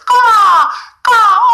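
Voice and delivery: crow caw. Pretend. Therapist imitates a crow to mock the patient, loud high caws. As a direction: squawk like a crow. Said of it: "You just see the birds".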